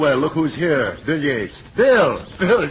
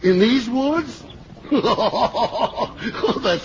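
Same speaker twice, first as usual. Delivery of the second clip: chuckling in disbelief; laughing; 7 semitones higher, much slower than usual. Acted. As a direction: laugh it off, slowly. Father laughs off worry.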